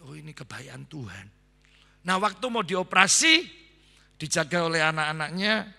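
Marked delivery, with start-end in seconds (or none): 0.1-1.3 quiet faith. Retold or imitated. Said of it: "dia langsung gini".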